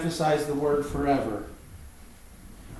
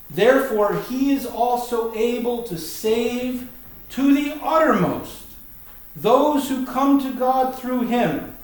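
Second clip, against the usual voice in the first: slow, higher-pitched ringing declaration; emphatic scripture reading; 5 semitones higher, slower than usual. Real, not acted. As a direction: proclaim the verse slowly, stretching the key words.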